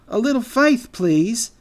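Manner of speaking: high, loud squirrel voice; exasperated plea. A narrator's reading. Squirrel rebukes doubting friends.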